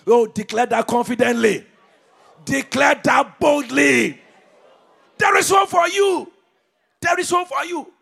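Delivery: loud, high shouted exhortation; fiery preacher shout; preaching at full force. Acted. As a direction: fire up the congregation.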